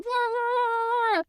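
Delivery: high-pitched drawn-out "waaah"; storybook creature's high yowl; loud animal cry. A narrator's reading. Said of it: "And with one loud".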